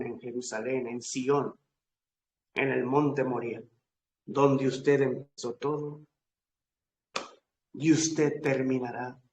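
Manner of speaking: ordinary talk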